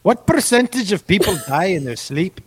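a bold put-on Irish accent, laughing mid-line; Irish accent breaking into a laugh. Shown as a demonstration. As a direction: go for a hammed-up Irish brogue. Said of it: "Oh, I nailed that".

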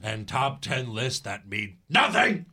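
slow, emphatic announcer parody; mock promo. Boss finishing his SmackDown pitch, mock grand promo. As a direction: drag out the slogan with mock grandeur.

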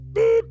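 high, loud beep sound; machine beep. Acted.

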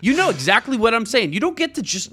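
high-pitched heated rant; getting fired up over names; worked up. Real, not acted. Ranting to his co-host about pronunciation, worked up. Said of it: "I'm getting way too worked up about it".

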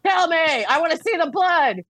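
a hammed-up plea for brutal truth; mock dramatic. She asks the Thoth deck for brutal readings, mock dramatic. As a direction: beg for harshness, playfully theatrical. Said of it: "I pull out the Thoth deck when I'm like".

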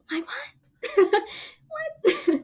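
high, breathy laugh-cry on what; half laughing, half crying in disbelief. Retold or imitated. Guest reliving the lost role, laughing through tears.

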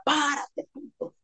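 high, hushed imitation of a shout; a distant friend's call, retold softly; mock yell. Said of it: "un raza me grita afuera".

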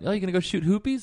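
high Australian accent, light mom impression. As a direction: do your Aussie mom asking a question. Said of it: "how my mom talks about".

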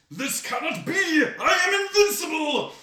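high shouting mad genius villain; megalomaniac rant. Pretend. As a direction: scream it like a supervillain losing. Voicing Tom Herman, mad genius.

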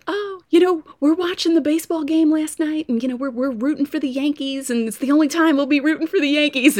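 high, far-off impression of a chatty local woman; Southern woman voice. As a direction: chirp it like a friendly Southern waitress. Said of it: "this woman was like".

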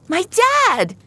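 put-on high, loud child's cry; panicked cry. Rescued girl to rescuer about her father, panicked cry. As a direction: cry out in alarm for a parent.

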